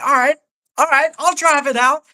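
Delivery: high, squeaky mock agreement; sarcastic high-pitched all right; mock high compliance.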